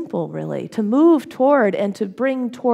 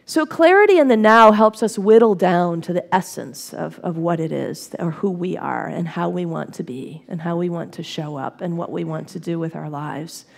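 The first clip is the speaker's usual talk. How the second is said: usual speaking voice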